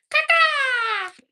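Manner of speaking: sudden high squeaky shriek of a nonsense word; silly squeal. Pretend. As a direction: blurt it out as a high, loud squeal. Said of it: "I don't know why I did that".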